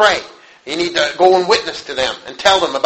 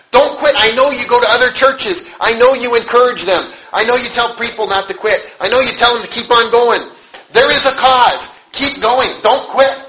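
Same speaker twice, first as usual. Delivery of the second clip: high, raised voice, driving each phrase; impassioned exhortation; 7 semitones higher, 3 dB louder than usual. Retold or imitated. Woman begging a preacher not to quit, impassioned exhortation. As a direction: urge them on with rising fervour.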